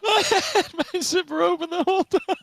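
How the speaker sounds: high, laughing through the words; laughing confession. Real, not acted. Admitting the open zipper to his cohost, laughing high. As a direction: spill it out while laughing.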